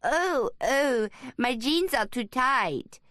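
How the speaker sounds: put-on high, slow hammy French accent; mock French accent. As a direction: camp it up in a silly French accent. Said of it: "calling out in a high voice with a mock French accent".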